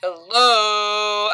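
loud, drawn-out baritone hello; mock-deep, long held call. A narrator's reading. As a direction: boom it out as deep as you can. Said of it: "as deep a baritone as I could manage".

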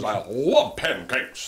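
slow, drawn-out intense declaration; Adam Driver. Retold or imitated. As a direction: stretch LOVE in an actor impression. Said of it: "I saw it and he goes".

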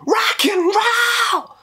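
high yelled rock and roll; rock shout. Shown as a demonstration.

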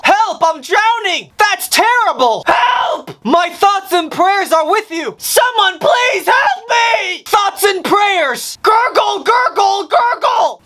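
high, desperate cries for help; drowning. Acted. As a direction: scream for help going under. Drowning man calling for help.

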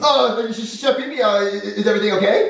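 put-on very high, stammering kid voice; Junior, nervous. Junior distracts the chef in the scene, nervous. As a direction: stammer to stall someone. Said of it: "And I'm gonna go".

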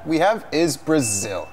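usual speaking voice

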